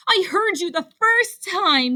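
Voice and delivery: high, exasperated yell. A narrator's reading. Rabbit fed up with Ribbit's croaking, yelling. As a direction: yell it impatiently. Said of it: "yelled Rabbit".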